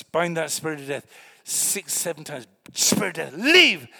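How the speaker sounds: loud, high rebuke; commanding shout. Retold or imitated. A preacher ordering the spirit of death out of his brother. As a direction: cast the spirit out with full force.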